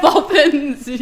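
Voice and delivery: loud, high laughing echo. Real, not acted. Echoing the word, laughing.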